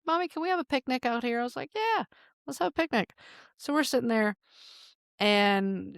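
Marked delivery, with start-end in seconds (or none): 0.1-1.4 put-on child voice. Daughter voiced in story. 1.7-3.1 high sing-song agreement. Retold or imitated. Mom agreeing in retold scene.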